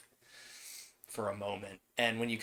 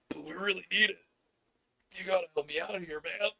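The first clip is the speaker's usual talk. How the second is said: lightly voiced NPC, higher and pleading; pleading on phone; 5 semitones higher than usual. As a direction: beg a friend down the line, a little higher. NPC begs spiders on phone.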